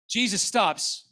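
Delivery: ordinary talk, nothing marked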